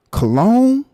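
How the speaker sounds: high scornful "Cologne?" rising; mocking disbelief. Real, not acted. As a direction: throw the word back in disbelief.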